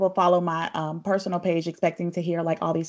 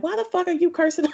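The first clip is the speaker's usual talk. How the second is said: higher-pitched mock mom scolding; imitating mom; 9 semitones higher than usual. Guest imitating her mother scolding her about cursing. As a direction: play the mom calling out her curse words. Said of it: "That's exactly how she would say it".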